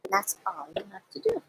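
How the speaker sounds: fast, hushed whisper. Real, not acted. Quiet aside while finishing.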